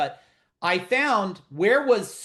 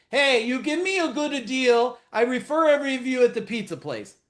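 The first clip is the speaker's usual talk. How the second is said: higher-pitched Italian restaurant owner; Italian accent; 6 semitones higher, 4 dB louder than usual. Retold or imitated. Restaurant owner pitching a referral deal to the speaker, Italian accent. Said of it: "The Italian guy that owns the Italian restaurant".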